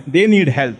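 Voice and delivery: normal talking voice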